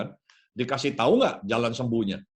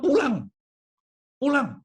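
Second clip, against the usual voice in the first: curt, high, louder command to go home; high, clipped angry "Pulang", repeated; angry order; 12 semitones higher, 3 dB louder than usual. Acted. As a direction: snap the order out in offended anger. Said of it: "Wah, dia marah".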